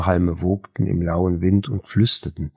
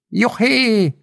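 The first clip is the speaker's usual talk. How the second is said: high, loud cry of joy like a gull call; jubilant whoop; 5 dB louder than usual. A narrator's reading. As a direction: whoop it out wildly from the moped. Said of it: "jubilierte er in seiner schnarrenden Diktion".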